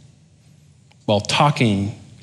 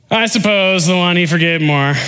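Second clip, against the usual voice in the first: high, grudging mock concession; sarcastic; 6 semitones higher than usual. Simon the Pharisee answering Jesus, sarcastic. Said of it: "hear the sarcasm".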